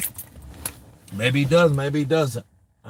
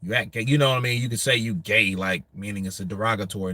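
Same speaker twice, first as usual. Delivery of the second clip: ordinary talk, nothing marked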